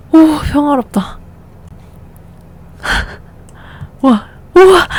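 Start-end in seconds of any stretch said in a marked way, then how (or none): 4.0-4.9 amazed wow. Real, not acted. Amazed at peaceful house.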